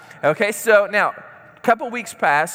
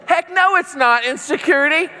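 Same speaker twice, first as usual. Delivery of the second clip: indignant denial; 9 semitones higher than usual. Retold or imitated. Speaker denies his blind spot.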